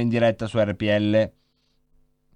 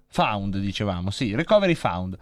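usual speaking voice

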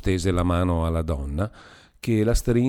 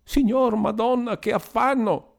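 high anguished wailing, much higher; distressed lament; 13 semitones higher than usual. A narrator's reading. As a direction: moan in distress. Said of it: "andava ripetendo". (lamento angosciato, voce molto acuta)

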